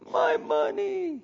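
high, slow mock wail over lost money; wailing merchant. Merchant wailing over lost riches, slow and high. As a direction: whine like a ruined merchant. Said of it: "weeping and wailing".

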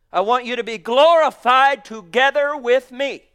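loud, ringing voice of God; fervent proclamation. Acted. Preacher voicing God's call to his bride, loudly. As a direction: proclaim it with full force. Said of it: "it's you if you let it grow".

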